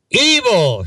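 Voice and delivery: high, drawn-out cry sliding down; shouted accusation. A narrator's reading. Older lady jeering at the parade float, shouted accusation. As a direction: call it out like a heckler in a crowd. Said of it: "shouted one older lady".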